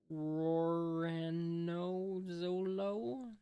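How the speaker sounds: drawn-out, very slow name reveal; dramatic reveal. Acted. Reading the bounty hunter's name, slow dramatic reveal. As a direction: stretch the name out for suspense.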